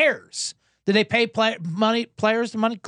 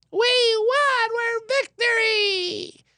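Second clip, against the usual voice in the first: mock Harbaugh mom cheer; much slower than usual. Mocking the Harbaugh family again.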